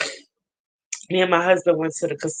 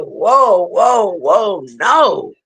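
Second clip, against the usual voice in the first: retold protest; 5 dB louder, much slower than usual. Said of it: "And I was like".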